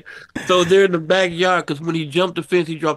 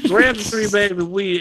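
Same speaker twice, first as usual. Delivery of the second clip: ordinary talk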